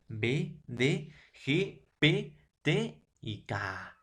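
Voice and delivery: each consonant burst out slowly, one by one; slow, exploded B, D, G, P, T, K; plosive sounds demo. Shown as a demonstration. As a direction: pop every stop for the class. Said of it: "Ahí está".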